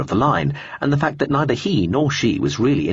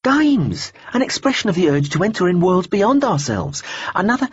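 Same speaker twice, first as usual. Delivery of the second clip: much higher, bright eager lad; a keen young believer butting in; eager young man; 10 semitones higher than usual. Acted.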